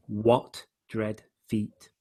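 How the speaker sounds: slow "what", each word set apart; three slow beats, first on "what"; stress on WHAT. Shown as a demonstration. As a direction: lean on "what", spacing out "dread feet". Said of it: "So he's bringing out".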